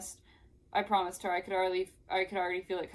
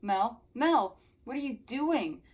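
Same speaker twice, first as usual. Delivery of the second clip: calling out, rising higher; acted alarm; 5 semitones higher, 5 dB louder, slower than usual. Jared calling to Mel after being shoved, startled higher voice. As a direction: question someone in startled disbelief.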